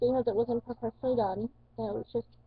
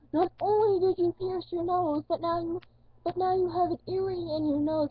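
higher, scolding mother voice; scolding mom; 7 semitones higher than usual. Retold or imitated. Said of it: "She's like".

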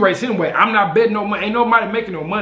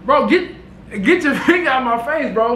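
backing off; 6 semitones higher than usual. Retold or imitated. Telling a pointing man to back off.

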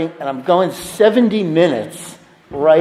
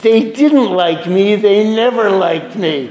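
Riff Raff; 5 semitones higher, slower than usual. Retold or imitated. Riff Raff answering his friend, a slow bitter sulk. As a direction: drag it out like a wounded tyrant. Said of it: "And he goes".

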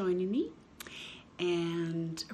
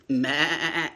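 sheep bleat; 5 semitones lower, 8 dB louder than usual. Acted. Sheep in the story.

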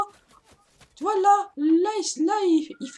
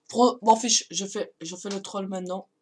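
ordinary talk

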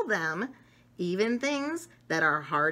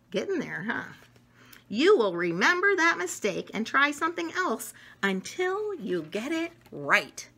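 ordinary delivery

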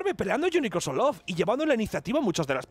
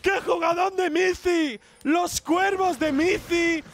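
high, drawn-out celebration of a play; triumphant esports play-by-play; 11 semitones higher, 4 dB louder, much slower than usual. Acted. Caster hails a player's great play, triumphant high call. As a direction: stretch the big call out slowly, up high.

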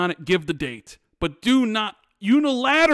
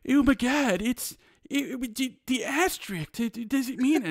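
higher, softer flustered mock voice; mocking defender; 5 semitones higher, 4 dB quieter than usual. Host mimicking online fans excusing the delay, flustered and mocking. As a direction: lightly send up a panicked fan.

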